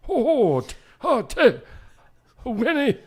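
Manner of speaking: high, hooting cartoon tiger, badly faked; Tigger. Host trying a new character voice live on air, high and hooting. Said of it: "I've never done a Tigger voice before".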